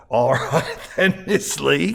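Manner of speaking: drolly amused, laugh in the words; droll. A narrator's reading. Kelbaugh going along with Miss Lee, droll and chuckling. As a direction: humour them with a light chuckle. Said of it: "Kelbaugh said drolly".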